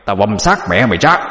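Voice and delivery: furious demon threat. A narrator's reading. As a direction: spit the threat at the coffin. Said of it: "mà mắng lên". (giọng quỷ gầm gừ đe dọa)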